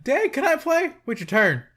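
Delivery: put-on high, loud little-kid plea; mock eager kid. Kid begging dad, voiced.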